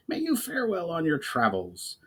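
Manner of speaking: a goblin chief wishing well; Brawl, kindly goblin. A narrator's reading. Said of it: "smiled, and said".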